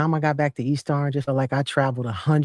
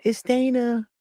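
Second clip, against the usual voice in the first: at the cousin's door; 8 semitones higher than usual. Retold or imitated. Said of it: "And I was like".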